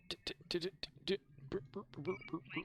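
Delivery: high clipped 'd-d-d' and 'brr' gun sounds, soft; imitated gunfire noises. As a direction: mouth machine-gun noises. Imitating gunfire while under attack.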